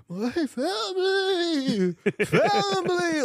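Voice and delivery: high, drawn-out spooky wail; mock ghost noises. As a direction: moan the words like a cartoon ghost. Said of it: "he's trying to make, like, ghost noises".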